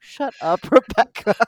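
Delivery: playful loud scolding; exasperated retort. Retold or imitated. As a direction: tell your sister off, half laughing. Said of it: "And I'm like".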